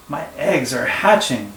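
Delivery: ordinary delivery, nothing marked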